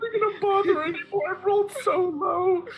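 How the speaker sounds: whiny anxious voice. Acted. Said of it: "manifestation of my anxiety".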